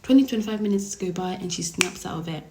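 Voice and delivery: usual speaking voice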